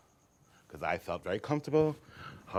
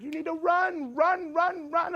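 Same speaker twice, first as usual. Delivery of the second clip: much higher, louder urgent yelling; coach yelling; 4 dB louder, slower than usual. Retold or imitated. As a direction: yell at the kid to run. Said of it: "the coach is like".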